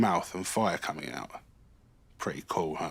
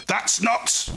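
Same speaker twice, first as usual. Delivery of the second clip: much higher, loud cut-off protest; angry outburst; 13 semitones higher, 4 dB louder than usual. Acted. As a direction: snap before catching yourself. Fergal feels fiery again.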